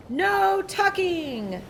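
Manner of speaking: high, slow drawn-out cry; crew cry. A narrator's reading. The crew rejects tucking in, slow high cry. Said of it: "the crew cried".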